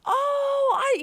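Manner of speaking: sudden high, loud cry of surprise; a startled yelp on the phone; surprised cry. Real, not acted.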